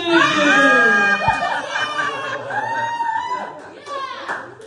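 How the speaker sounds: loud high shriek of laughter; comic reacting to the elf reveal, shrieking with laughter. Real, not acted. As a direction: burst out in a shocked squeal. Shocked laugh at the memory.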